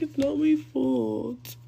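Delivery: mocking, stretched-out protest; mock excuse-making. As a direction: mimic him pleading innocence, slowly. Imitates his excuse when caught.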